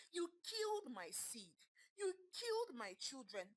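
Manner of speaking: high, cracking voice near tears; husband mourning his lost child, tearful and cracking. Acted. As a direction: choke out the loss.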